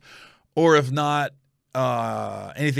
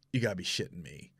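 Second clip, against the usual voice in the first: much lower, soft dismay; quiet disbelief; 8 semitones lower, 11 dB quieter than usual. Retold or imitated. Man watching her run into his room, low disbelief. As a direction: drop to a deflated undertone. Dismay as she runs inside.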